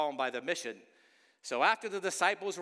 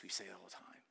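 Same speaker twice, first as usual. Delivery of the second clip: ordinary talk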